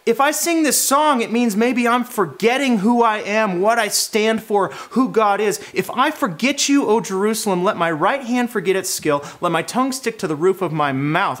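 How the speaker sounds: loud, high defiant vow; impassioned vow. Retold or imitated. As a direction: swear it with burning resolve. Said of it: "He says".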